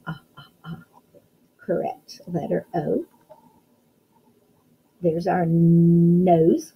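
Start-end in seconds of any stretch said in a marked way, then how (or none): 0.1-0.8 "Ah, ah, ah" as soft, short separate sounds; isolated vowel sound. Shown as a demonstration. Teacher sounding out orange, soft separated "ah, ah, ah". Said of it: "hear at the beginning of the word orange". 5.4-6.7 nose with a long held "nnn"; stretched first sound. Shown as a demonstration. Teacher showing the first sound, long N in nose. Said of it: "hear at the beginning of the word nose".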